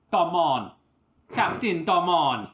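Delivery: put-on loud Jamaican accent, drawn out; booming Jamaican pirate captain. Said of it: "what accent are we going with".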